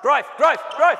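loud shouted drill command; coach calling out. Real, not acted. Coach yelling to players to drive. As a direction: bark the cue across the court.

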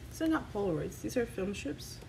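usual speaking voice

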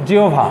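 জিহ্বা said as "জিওভা", with the h and b sounds merging; correct pronunciation. Shown as a demonstration. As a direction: say জিহ্বা carefully and clearly, as জিওভা. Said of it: "এটা কিন্তু শুদ্ধ উচ্চারণ". (জিহ্বা শুদ্ধ উচ্চারণ, "জিওভা")

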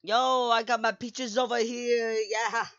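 high, loud, playful boast; hyped joke. Pretend. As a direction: shout it out like a hype man. Joking about song Peaches.

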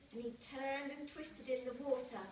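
usual speaking voice

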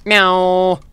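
high, loud, drawn-out meow; cat meow. Pretend. Player meowing through the game, loud cat voice.

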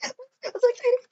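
high laughing squeal; giddy laughing squeal; excited squeal. Real, not acted. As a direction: burst out giggling with joy. Laughing squeal then flat repeat.

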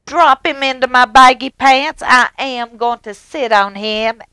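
high, loud gleeful king command; king ordering servants, loud and gleeful; king shouting orders. Acted.

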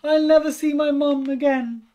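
high, loud despairing lament; lost child's distraught cry; wailing. A narrator's reading. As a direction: wail it out, bereft. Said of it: "she wailed".

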